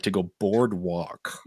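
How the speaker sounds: careful mock attempt at an American "boardwalk"; attempted American accent. Imitating Australian friend trying boardwalk, effortful American accent. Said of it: "try to get her mouth right".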